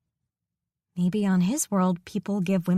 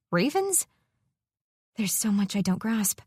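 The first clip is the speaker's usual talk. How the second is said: usual speaking voice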